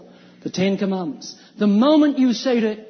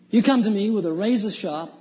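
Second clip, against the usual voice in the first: normal talking voice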